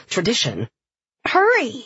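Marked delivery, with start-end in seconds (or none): 1.2-1.7 high, urgent call to hurry. A narrator's reading. Frida hurrying Emily from another room, calling out. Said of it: "Frida called".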